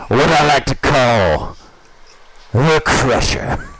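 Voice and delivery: slow, drawn-out announcer hype; fighting game announcer. Acted. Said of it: "It's my announcer voice".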